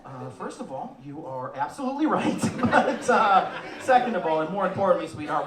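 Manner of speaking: ordinary talk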